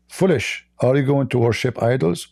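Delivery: ordinary talk, nothing marked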